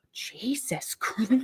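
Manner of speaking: drawn-out, hushed exclamation; retold under-the-breath shock; stunned whisper. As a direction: whisper it slowly in disbelief. Retelling her stunned muttered reaction.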